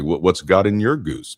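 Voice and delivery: ordinary talk, nothing marked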